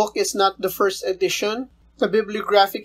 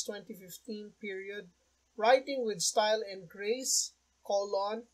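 much softer reading voice; quiet; 15 dB quieter than usual. Real, not acted. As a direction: read it quietly, far from the mic. Same lesson, much quieter.

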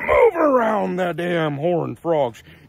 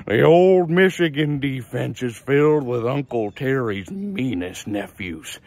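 ordinary talk, nothing marked

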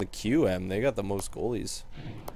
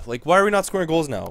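frustrated outburst; 7 semitones higher, 6 dB louder than usual. Real, not acted. Frustrated at his losing team.

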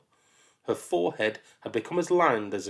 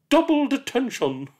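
high, loud clipped verdict; stern teacher; 7 dB louder than usual. Acted. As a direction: raise the penalty. Teacher escalates.

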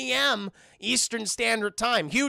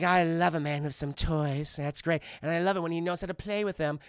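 seductive; 4 semitones lower than usual. Retold or imitated. Hyena flirting with Dingo.

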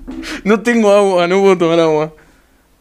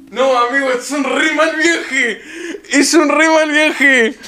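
higher, slow, half-laughing, half-crying; real laughing lament, slowed down; laughing overwhelmed; 6 semitones higher than usual. Real, not acted. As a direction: drag it out, giggling and overwhelmed.

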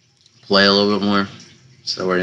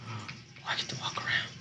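whispered, almost silent; 17 dB quieter, faster than usual. Acted. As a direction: breathe it out in a whisper. Whispered aside in game.

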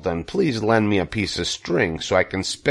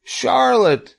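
high, louder wail of a name; anguished moan; 5 dB louder than usual. A narrator's reading. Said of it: "he moaned".

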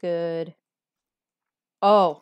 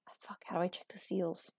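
whispering; 4 semitones lower, 12 dB quieter, faster than usual. Real, not acted. Unvoiced whisper.

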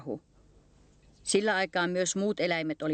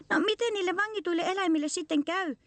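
high-pitched piping mouse voice; squeaking mouse; 12 semitones higher than usual. A narrator's reading. Mouse asks the bear about captive animals, high squeak. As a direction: pipe the question up high like a mouse. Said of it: "hiiri piipitti".

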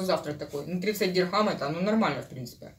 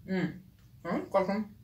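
savouring a bite, mumbling; chewing while talking; 5 dB quieter than usual. Real, not acted. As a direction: mouth partly full of food. Seen 2: Spoon to mouth at -2 and -1; closed lips, chewing motion through ~1.8.

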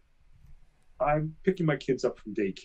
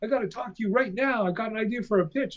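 imitating the writer who insists on pitching now; pushy caller impression; 8 semitones higher than usual. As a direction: rattle it off like a caller who won't wait. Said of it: "He goes".

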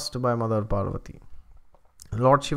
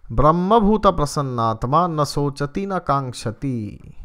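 louder, chanted Sanskrit verse; verse recitation; 5 dB louder than usual. Acted. The preacher quoting Krishna in the Gita, chanted recitation. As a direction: intone the shloka with weight.

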